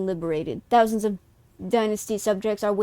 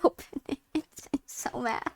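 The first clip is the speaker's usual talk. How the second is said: usual speaking voice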